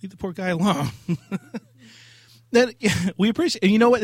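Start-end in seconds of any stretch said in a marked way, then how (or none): 1.1-4.0 high, loud laughing talk. Real, not acted. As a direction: laugh through the words. Laughing after the clip.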